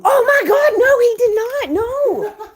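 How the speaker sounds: loud, high laughing disbelief; shocked outburst. Real, not acted. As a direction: shriek it in happy shock.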